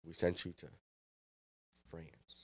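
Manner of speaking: low, hushed, halting chef voice; imagined looming chef. Pretend. Imagined chef towering over the young cook, mock-ominous. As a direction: draw it out quietly, word by word, as a mock-ominous boss.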